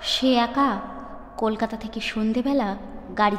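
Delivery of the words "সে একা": plain reading voice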